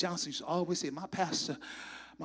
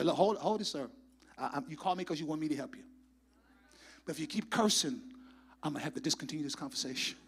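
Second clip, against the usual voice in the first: softer, fast, calm customer-service voice; calm professional; 3 semitones lower, 5 dB quieter, faster than usual. Pretend. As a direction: stay polite and even with the angry caller. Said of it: "I'm gonna say professional a hundred percent".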